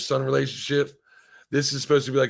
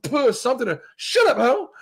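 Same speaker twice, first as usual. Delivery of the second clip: high, loud mock outburst rising to a squeak; hammed-up exasperation at a game character; 12 semitones higher, 4 dB louder than usual. Streamer acting out yelling at a game character, high and loud mock outburst.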